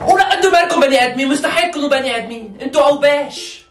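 mother yelling at the family; angry scolding. Acted. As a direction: rush out the insult in a fury.

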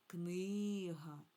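"книга" with a long, stressed и; stress on first syllable. Shown as a demonstration. Teacher contrasting singular with plural, first syllable stressed in "книга". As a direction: stretch the и in "книга", light final syllable. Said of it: "тоді на перший склад".

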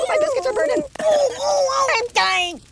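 high, mock cries of a burning toy; hammed-up panic. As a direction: wail like the oven is roasting you.

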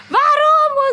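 high, loud outraged "warum?"; mother's shout. Retold or imitated. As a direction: shout it like an indignant mom. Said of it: "Die so". (die Mutter ruft empört und laut)

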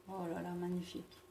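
soft, fast awed murmur. Real, not acted. Admiring the eyeshadow.

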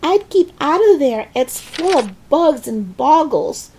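put-on high, cautioning boy's call. A narrator's reading. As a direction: warn her from down below. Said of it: "George called out".

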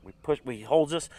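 ordinary talk, nothing marked

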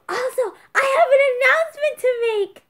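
high, loud squealing outburst; gasp, then build to a shriek; over-the-top frantic excitement; hysterical announcement. Acted. Mock-frantic announcement of first dislike, then apologises.

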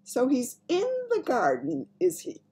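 high, amused query; smiling a little. A narrator's reading. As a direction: ask with a slight smile. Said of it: "she said, smiling a little".

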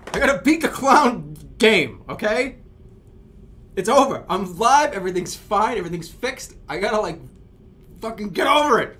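loud, high, emphatic pep talk; exasperated shouting at yourself; frustrated self-scolding. Real, not acted. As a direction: order yourself to calm down, at full volume.

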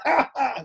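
high mock laughter; high-pitched "ha ha" in imitation; imitated giggling. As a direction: laugh like a tickled child. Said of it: "they're laughing their heads off".

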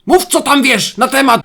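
high, loud sudden demand; mock interrogation shout. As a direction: yell it to scare the listener as a joke. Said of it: "Ktoś, kto ma coś za uszami, się przestraszył". (żartobliwy, groźny krzyk przesłuchującego)

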